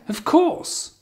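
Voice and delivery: "of course" extremely high, rising at the end; high pitch intonation. Shown as a demonstration. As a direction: model an exaggerated friendly "of course". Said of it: "Can you hear the intonation?".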